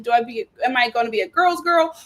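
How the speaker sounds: ordinary delivery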